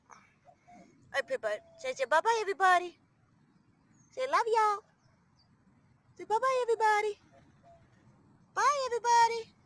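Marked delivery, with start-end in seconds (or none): 1.8-9.5 high, louder sing-song bye; sing-song goodbye. Real, not acted. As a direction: coax the bird to wave bye. Coaxing bird to say bye.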